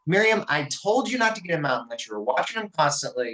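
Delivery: half-acted scolding of the daughter; retold snapping. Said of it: "I snapped at her".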